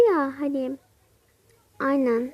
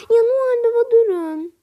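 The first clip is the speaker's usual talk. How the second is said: excited call; 8 semitones higher, 5 dB louder than usual. Acted. Noel Baba'yı durduruyor.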